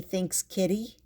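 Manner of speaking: usual speaking voice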